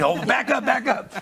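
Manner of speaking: a light, amused mock order to the crowding kids; laughing shoo. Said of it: "there's Katie saying".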